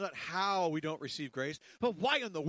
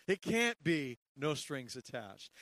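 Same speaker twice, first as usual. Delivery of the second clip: ordinary talk, nothing marked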